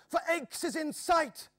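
high, loud joyful shout; excited cry. A narrator's reading. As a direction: burst out at the sight of the town. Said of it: "gasped Joris".

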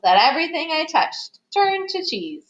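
lifted mouse-character voice; wishing mouse. A narrator's reading. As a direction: make an eager storybook wish. Mouse makes his wish.

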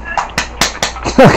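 soft airy giggle; unvoiced giggle. Real, not acted. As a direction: giggle under your breath. Laugh after the squeal.

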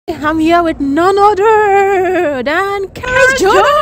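slow, drawn-out big reveal; hyped introduction. Real, not acted. Hyped introduction of a guest YouTuber.

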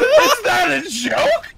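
very high, drawn-out disbelief; incredulous. Real, not acted. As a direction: squeal it slowly in outrage. Incredulous reaction in game.

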